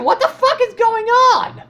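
high, loud shocked yelling; startled outburst. Real, not acted. Gamer reacting to chaos on screen, shocked high shout. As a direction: yell it in real disbelief, pitch leaping up.

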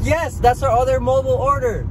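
high, strained, fed-up answer; exasperated reply. Retold or imitated. Retelling answering the worker, high and exasperated. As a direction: spell it out in disbelief. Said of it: "We're like".

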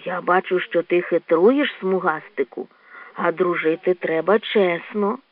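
low, slow, soft admonishing; gentle reproach. Acted. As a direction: chide a friend gently, unhurried. Raccoon gently reproaches the badger. (лагідно дорікаючи)

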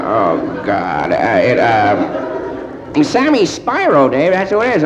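ordinary talk

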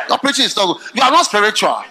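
ordinary talk

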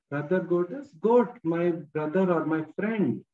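normal talking voice

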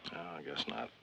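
barely voiced admission; almost whispered, resigned; under his breath. Father concedes under his breath.

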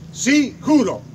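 firm, energetic oath. Retold or imitated. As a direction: declare it with conviction. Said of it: "con voz firme, enérgica y quebrada por la emoción".

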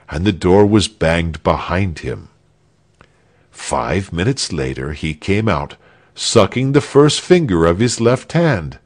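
usual speaking voice

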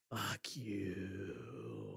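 low, quiet muttered curse under the breath. Retold or imitated. The man curses passersby on the street, muttered low. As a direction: drop the voice and mutter it. Retold stranger cursing on street.

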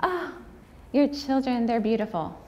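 light imitation of a chatty shopper; chatty stranger. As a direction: play the sweet nosy lady at the checkout. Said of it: "wants to talk".

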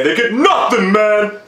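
a weak stab at Rocky; Rocky impression. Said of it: "As Rocky would say".

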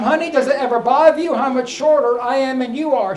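normal talking voice

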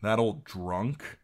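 slow, mildly scornful question. A narrator's reading. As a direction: drag it out with a touch of disdain. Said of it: "said Gendry scornfully".

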